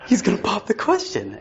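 thrilled falsetto of discovery; excited squeal. Retold or imitated. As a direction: squeak it out, bursting with the secret. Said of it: "and I'm like".

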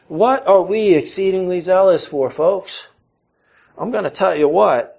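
usual speaking voice

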